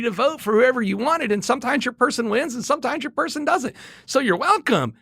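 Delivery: high, over-patient explaining; mock patient. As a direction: explain it sweetly like to a child. Mock helpful retort, high voice.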